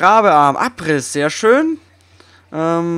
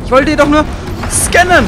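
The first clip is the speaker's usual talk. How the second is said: frustrated; 8 semitones higher, 6 dB louder than usual. Real, not acted. Machine attacks while scanning.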